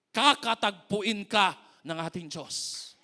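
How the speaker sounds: loud, slow, emphatic promise; building preaching shout. Acted. Pastor to congregation, rising to a shout. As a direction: build to a full shout of reassurance.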